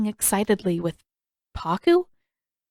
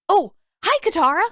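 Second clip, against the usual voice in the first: cheerful greeting; 10 semitones higher, 5 dB louder, faster than usual. Acted. Aang greets Katara brightly.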